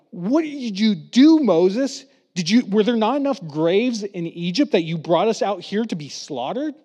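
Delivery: high, loud accusing cry at Moses; angry grumbling. Retold or imitated. Israelites trapped at the sea accuse Moses, high loud grumbling. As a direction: throw the blame at him, rising and loud. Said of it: "they start grumbling".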